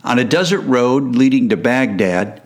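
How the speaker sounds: ordinary talk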